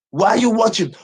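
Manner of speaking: normal talking voice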